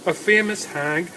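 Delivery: plain reading voice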